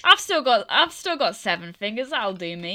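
laughing send-up of Nin's calm; mock Nin, hammed-up. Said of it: "re-doing Nin's line".

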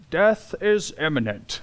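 mock-grave British narrator voice; David Attenborough. Preacher mimicking a narrator on wolves hunting, British accent. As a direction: try on a British documentary accent. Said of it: "I'm gonna stop with the voice".

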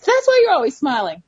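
lightly voiced youngster catching on; child's realization. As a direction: mimic a child who just figured it out. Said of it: "She said".

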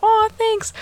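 touched thanks. Retold or imitated. Singer thanking an adoring fan, high cooing imitation. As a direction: coo a sweet thank-you.

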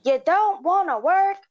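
high mock tirade. Customer ranting at the cashier, mock angry tirade. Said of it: "he snapped".

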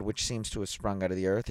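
plain reading voice

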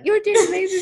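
mock-gushing praise; meme quote. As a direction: lightly put on a meme's gushing encouragement. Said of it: "You know that meme?".

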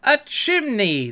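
sudden high surprised cry; high, slow gasp of discovery; exclaimed. A narrator's reading. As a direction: blurt out the discovery. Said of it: "they both exclaimed".

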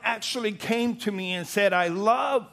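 loud, high, fervent preacher's cry; thundering sermon delivery; full-force preaching. Acted. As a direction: preach it out with fire.

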